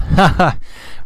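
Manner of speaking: quick embarrassed laugh; short laugh. Real, not acted. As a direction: laugh it off lightly. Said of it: "I got some candy in my mouth".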